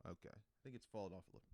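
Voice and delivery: usual speaking voice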